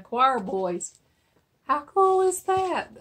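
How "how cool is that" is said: high, louder, slow delighted exclaim; excited. Real, not acted. Seller showing Christmas albums to viewers, excited. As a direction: gush over the find.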